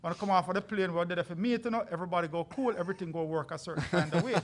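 playful Jamaican patois voice; Jamaican accent. Pretend. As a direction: lay on a thick Jamaican accent. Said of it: "I'll even put on my Jamaican accent".

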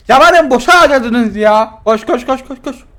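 loud frantic urging; run-for-your-life panic; being chased. Real, not acted.

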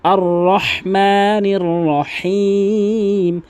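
রহমানির with a breathy throat হা, drawn slowly; correct recitation. Shown as a demonstration. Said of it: "রহিম এখানে কিন্তু বড় হা".

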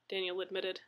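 ordinary delivery

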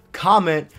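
loud punchy callout; shouted. Acted.

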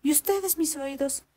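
voz de zorra aguda y viva, más alta; zorra astuta. Acted. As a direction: una zorra lista que pregunta con curiosidad. (la zorra pregunta al campesino asustado, voz aguda y curiosa)

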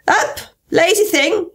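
high, drawn-out royal command; imperious Queen. Acted. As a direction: order the sleeper up like a bossy queen. Queen orders the sleeping griffin up.

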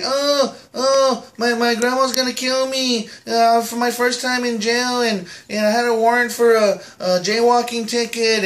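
put-on high, whimpering little-kid voice; crying kid voice. [name] imitates the crying kid in jail, high and whiny. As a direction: play the scared kid crying in the holding cell. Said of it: "He's like".

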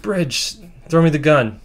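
urgent retold shout, lightly acted; calling out. Storyteller as a hero asking Bridge for the gun, calling out.

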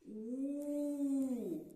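У held long and clear for the class; drawn-out vowel. Shown as a demonstration. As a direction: stretch the vowel У slowly. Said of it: "Який другий звук?".

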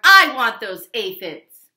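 loud, slow grumpy demand; loud grouchy ladybug voice. Acted. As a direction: claim the food angrily.

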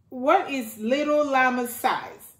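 usual speaking voice

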